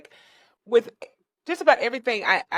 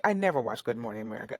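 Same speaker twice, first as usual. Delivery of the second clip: ordinary talk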